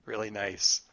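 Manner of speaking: ordinary delivery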